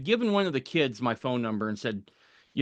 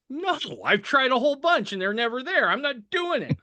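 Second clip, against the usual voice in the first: put-on high whiny voice of a stubborn kid; mock whiny protest, much higher; whiny refusal; 11 semitones higher than usual. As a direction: whine it like a stubborn child refusing.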